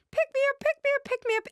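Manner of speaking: high-pitched pleading little-dog voice; anxious little dog. Acted. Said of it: "it gets anxious and it jumps up on".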